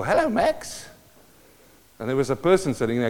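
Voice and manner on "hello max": high squawky parrot greeting. Retold or imitated. Preacher imitating the parrot greeting visitors at the vet, squawky. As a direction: sound like the bird mimicking. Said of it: "and he go".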